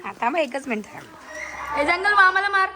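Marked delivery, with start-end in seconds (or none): 1.8-2.7 higher, louder egging on; rally the dog with a loud call. Real, not acted. Family urges the dog to attack an uncle, loud playful calling.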